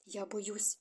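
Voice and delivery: very soft, fearful near-whisper. A narrator's reading. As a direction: drop to a hushed, timid confession. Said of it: "прошепотіла вона". (боязко, майже пошепки)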